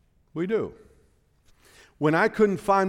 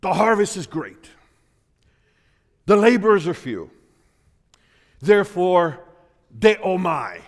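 forceful proclamation; 3 semitones higher, 5 dB louder than usual. Real, not acted. Preacher proclaims verse forcefully.

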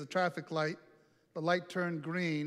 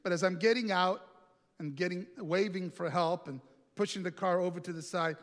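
ordinary delivery

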